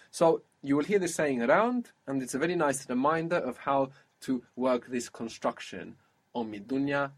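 ordinary talk

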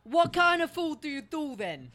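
slow, put-on New Yorker; New York accent. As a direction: drag it out in mock New York. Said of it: "a New Yorker".

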